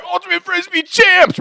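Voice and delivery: high-pitched victory cheer; reenacted triumphant chant; celebrating. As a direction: crow it like new champions. Drunken celebration retold.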